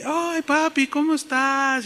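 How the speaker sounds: high-pitched sweet greeting; affectionate daughters. Retold or imitated. Sisters greeting their papi, high and affectionate. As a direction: fawn over daddy in a girlish high voice. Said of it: "Rosa y Georgina".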